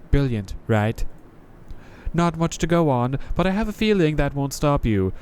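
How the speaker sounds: a jaded knight greeting a newcomer; Crestfallen Knight voice. Acted. Knight explains the bells.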